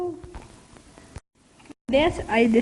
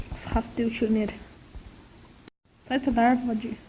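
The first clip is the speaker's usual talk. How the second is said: ordinary talk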